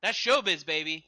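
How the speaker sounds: loud, hammed-up showbiz punchline; showbiz flourish. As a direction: sell it like a showman.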